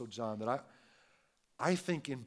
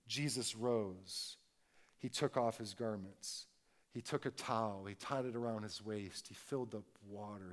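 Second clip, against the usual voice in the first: slow, soft, lower, each step set apart; slow frame by frame; 4 semitones lower, 5 dB quieter than usual. Shown as a demonstration. Preacher slowing the foot washing down frame by frame, softly. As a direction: lay out each moment slowly, one frame at a time. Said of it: "show us frame by frame by frame".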